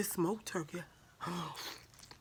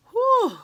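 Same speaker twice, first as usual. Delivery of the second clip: coughing; 11 dB louder than usual. Real, not acted. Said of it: "In the wrong pipe".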